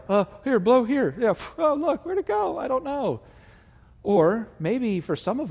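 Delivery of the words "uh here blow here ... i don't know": high, hammed-up fake innocent magician; feigned innocence. Pastor acting us dodging God like a trick, high mock innocence. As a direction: play dumb while hiding the coin. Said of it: "and we're like".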